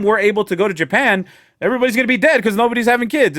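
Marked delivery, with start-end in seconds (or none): none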